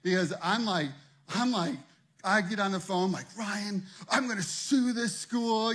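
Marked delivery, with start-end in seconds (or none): 3.3-5.7 frantic, slow-dragged panic; freaking out. Retold or imitated. Pastor on phone to friend, panicking. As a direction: blurt it out in a panic.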